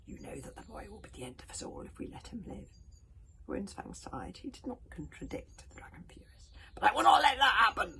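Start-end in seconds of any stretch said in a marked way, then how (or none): 0.1-2.7 bitter dragon. Acted. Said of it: "tone was still bitterly resentful". 6.7-7.9 loud defiant dragon vow; enraged dragon swearing defiance; dragon roar. Acted. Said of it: "roared the Dragon Furious".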